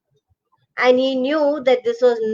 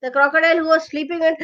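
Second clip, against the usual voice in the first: usual speaking voice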